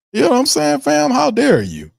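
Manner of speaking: sharply high, indignant mock squeal; mock outrage. Ranting host to viewers, squealing high in mock outrage. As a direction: shriek it in fake indignation.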